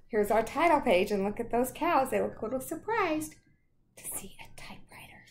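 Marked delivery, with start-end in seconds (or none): 4.0-5.3 whisper. Shown as a demonstration. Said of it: "reading aloud".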